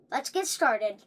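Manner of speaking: plain reading voice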